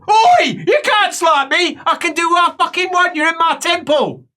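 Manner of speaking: high shout, opening on a loud burst; acted angry quarrel. Helper answering patient in her temple, acted furious shout. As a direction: assert your authority at full pitch.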